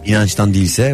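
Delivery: ordinary talk, nothing marked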